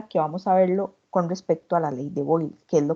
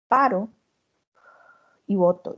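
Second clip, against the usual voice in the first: breathing demo. Shown as a demonstration. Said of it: "yo hago esto".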